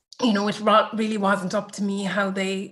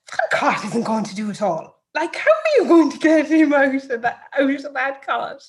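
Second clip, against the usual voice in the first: mum's exasperated protest; 5 semitones higher, 6 dB louder than usual. Retold or imitated. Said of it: "Mum went".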